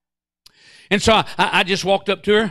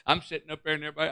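normal talking voice

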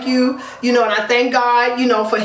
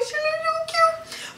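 very high, soft, slow coo; a tender coo about mom's new look; 7 dB quieter, slower than usual. Real, not acted. Gushing about her mother's haircut.

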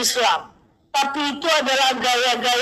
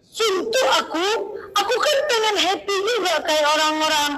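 higher-pitched mock whining; acted-out grumble, pitched up; complaining friend; 6 semitones higher than usual. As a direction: imitate a fed-up friend moaning. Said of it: "banyak kali nih keluhannya".

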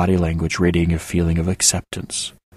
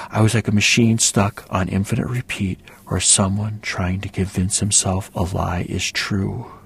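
plain reading voice

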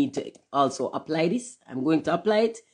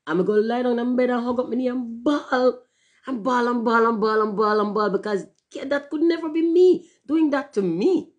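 mock wailing; 7 semitones higher, 4 dB louder than usual. Mock self-pity about bawling.

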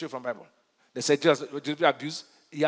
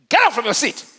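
louder, higher order; acted command; 5 semitones higher, 8 dB louder than usual. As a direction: order someone up out of the seat.

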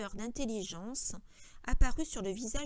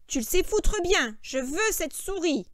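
loud, raised, insistent order; angry demand; 7 semitones higher, 9 dB louder than usual. A narrator's reading. George demanding the mouse from Lenny, angry. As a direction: demand it forcefully, stretching the end.

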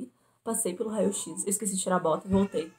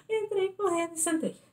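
laughing high; 11 semitones higher than usual. Real, not acted. Recalling panic, crying sound.

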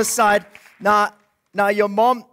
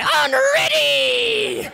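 much higher, slow puppet wolf drawl; much slower than usual. Acted.

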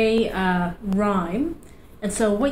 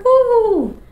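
a high, loud joyful woo; excited whoop; 13 semitones higher, 7 dB louder than usual. Acted. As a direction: cheer the flower opening. Flower blooms in rhyme.

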